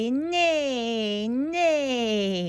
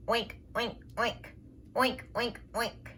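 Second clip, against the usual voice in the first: soft oink; 3 semitones lower, 9 dB quieter, faster than usual. Pretend. Pig in the mud.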